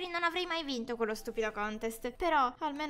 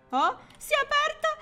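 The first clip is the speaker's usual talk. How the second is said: high, loud joyful gasp of surprise; the door gives way at last; surprised delight; 13 semitones higher, 7 dB louder than usual. Acted. Trapped girl as the door opens, high delighted surprise.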